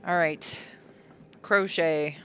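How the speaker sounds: normal talking voice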